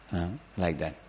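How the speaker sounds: ordinary talk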